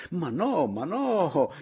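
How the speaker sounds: high, loud objection; exclaimed protest. A narrator's reading. Paleari objects to the company, loud exclaiming. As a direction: cry out to overrule them. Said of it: "esclamò Paleari".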